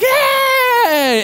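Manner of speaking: high-pitched drawn-out cheer; long, high imitated "yay"; kids' cheer. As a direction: cheer like a carload of amazed kids. Retold kids cheering dad's trick.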